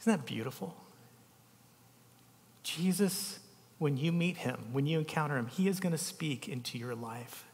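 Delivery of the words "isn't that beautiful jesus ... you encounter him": usual speaking voice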